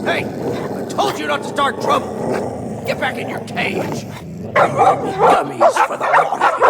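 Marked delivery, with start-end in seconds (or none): 4.5-6.6 loud, high jeer; insult. Acted. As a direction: spit it at the dogs. Insulting the poodles.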